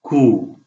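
खू with throaty kh and rounded oo; German Q sound. Shown as a demonstration. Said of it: "आप समझिए काफ पेश वाव". (खू गले से, होंठ गोल करके)

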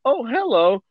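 put-on high, sweet old lady greeting. Retelling the granny turning to the Martians, high sweet greeting. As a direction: greet them like a cheerful granny.

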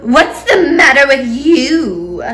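angry screeching aunt; screeched. A narrator's reading. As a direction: screech it at the boy. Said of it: "Aunt Spiker screeched".

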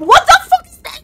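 very high, loud shriek of fright; shrieked, panicked alarm; terrified scream. Real, not acted. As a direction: scream in real shock at what appeared. Screams at scare in game.

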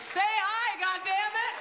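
high, loud impatient outburst; mock exasperated yell. Friend on the phone fed up with coaxing, mock yell. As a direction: blow up in comic frustration. Caller loses patience with coaxing.

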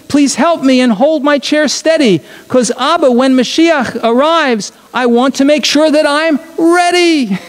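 put-on high child's voice, pleading eagerly; eager little girl. Sarah asking her father for help, high and eager. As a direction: beg Abba like an excited small daughter. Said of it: "Sarah exclaimed".

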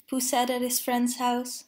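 usual speaking voice

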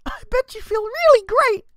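mock the player's pride from up high; Flowey voice again. Streamer voicing Flowey's sarcasm at the player, high loud sneer. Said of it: "I can't do it".

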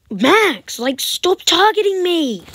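high, loud protest; yelling at opponent. Real, not acted. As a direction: shout at the player hounding her.

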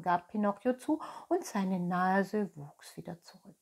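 ordinary delivery

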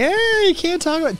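squeaky hammed-up objection; mocking scold. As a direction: parody a scolding critic. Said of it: "the feminists of media would be like".

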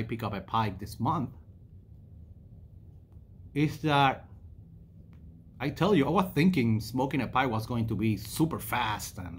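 puffing between words; talking around cigar; cigar in mouth. Real, not acted. Seen 1: cigar held in lips from 1.6s, puffing smoke while speaking.

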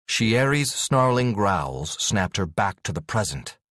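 plain reading voice

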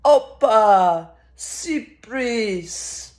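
loud, drawn-out mock cheer that eases off; mock Greek-style shout, first word loudest; retold yell. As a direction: imitate a diner yelling out a Greek cheer. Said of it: "the person next to me yells out".

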